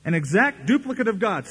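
rally the crowd like a faith preacher; mimicking the preacher. Quoting a faith preacher to his own flock, hyped-up. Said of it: "I won't be able to do it justice".

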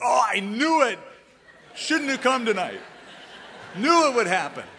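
high, loud wail of doom; hammed-up fatalistic cry, laughing; mock despair. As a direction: ham up the gloomy I-knew-it. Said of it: "if I were to say".

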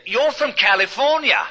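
high-pitched imitation of a Londoner's accent; London accent. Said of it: "the Londoner".

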